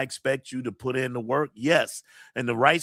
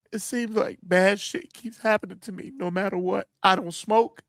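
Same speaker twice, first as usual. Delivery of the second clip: higher, tearful voice breaking up; really crying; 5 semitones higher than usual. Real, not acted. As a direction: plead through real tears. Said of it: "I'm gonna cry again".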